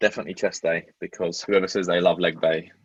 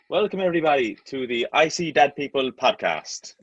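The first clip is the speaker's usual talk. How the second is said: put-on light Irish accent, slightly higher; 6 semitones higher than usual. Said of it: "Can you say something in an Irish accent?".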